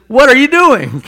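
loud, high demand from an outraged king; king confronting the unforgiving servant, outraged; angry king's rebuke. Retold or imitated. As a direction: bark it at the servant.

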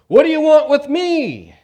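loud, high, sustained demonic shout; the possessed man screaming at Jesus. Acted. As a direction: scream the question full force. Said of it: "he shouts".

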